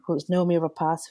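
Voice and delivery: usual speaking voice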